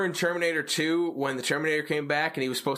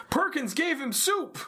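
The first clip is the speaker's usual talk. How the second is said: "Perkins gave him soup!" much higher, rising on "soup"; excited repeat; 11 semitones higher than usual. Retold or imitated. Jake telling the others his news, mock excited. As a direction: repeat it with mock disbelief, climbing high. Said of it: "and Jake's like".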